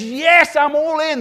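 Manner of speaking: high, loud burst of enthusiasm; over-keen volunteer. Acted. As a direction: shout it like an eager volunteer.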